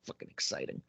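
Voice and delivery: hushed, awed whisper; excited whisper. Real, not acted. Fan to co-host about wrestling's next chapter, excited whisper.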